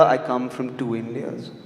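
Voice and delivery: normal talking voice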